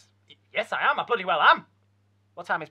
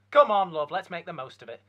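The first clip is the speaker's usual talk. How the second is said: usual speaking voice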